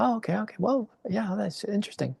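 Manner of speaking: high, hushed whisper. Retold or imitated. He whispers back to his girlfriend about the celebrity, hushed. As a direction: whisper it so the celebrity can't hear. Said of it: "and I was like".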